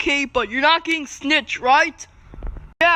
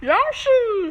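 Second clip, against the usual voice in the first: ordinary talk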